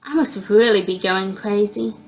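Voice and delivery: ordinary delivery, nothing marked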